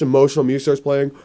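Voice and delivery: ordinary talk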